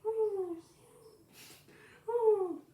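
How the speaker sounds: very high, loud hooting; shrill owl hoot. Acted. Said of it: "Let me try it again".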